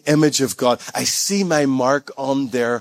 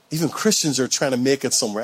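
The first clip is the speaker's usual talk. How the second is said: ordinary talk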